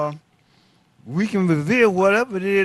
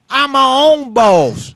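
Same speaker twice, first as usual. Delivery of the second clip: loud, slow, raised-pitch retort; emphatic defiance; 4 semitones higher, 5 dB louder, slower than usual. Retold or imitated. Man retelling his answer to threats of firing, defiant. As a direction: declare it slowly and forcefully.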